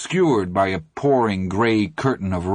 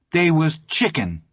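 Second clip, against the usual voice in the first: quick high retort; shot back; 7 semitones higher than usual. Acted. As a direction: fire it back. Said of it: "Teddy shot back".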